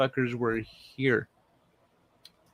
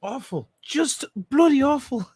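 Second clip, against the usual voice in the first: high-pitched hammed-up Irish accent; exaggerated Irish lilt, high; put-on Irish accent; 11 semitones higher, 3 dB louder than usual. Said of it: "that accent should have impressed".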